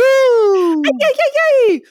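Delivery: excited whoop; high squealing cheer. Acted. Cheering intro.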